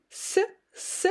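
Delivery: S, s hissed with no voice at all; just air on S, s, no buzz; voiceless s demo. Shown as a demonstration. Teacher contrasting s with z, a quiet voiceless hiss. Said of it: "I just don't engage my vocal cords here".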